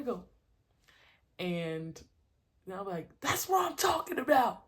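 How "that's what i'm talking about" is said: loud, high cheer of approval; hyping up. Retold or imitated. Coach cheering till they start smiling, hyped. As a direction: yell to gas the kid up. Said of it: "I gas them up a lot".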